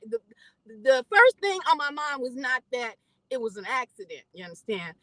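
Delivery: ordinary talk, nothing marked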